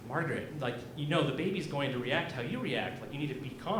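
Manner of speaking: hushed voice of reason, acted; calm sister-in-law. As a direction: gently talk an overwrought relative down.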